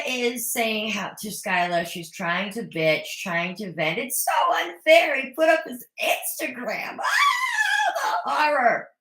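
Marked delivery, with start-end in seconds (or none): 4.0-7.0 a lightly hammed-up, higher whiny complaint; mock whine. Vlogger mocking Angela venting to Skyla, mock whine. 7.0-8.9 mock horror. Vlogger mocking Angela's outrage at Michael, melodramatic wail.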